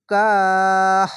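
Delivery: slow chanted tilawat, vowel stretched long; Quran recitation. Shown as a demonstration. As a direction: intone the word as in Quran reading. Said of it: "খাড়া জাবারে এক আলিফ টেনে পড়বেন".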